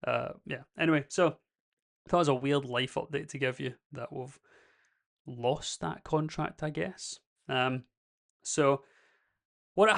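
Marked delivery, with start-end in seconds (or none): none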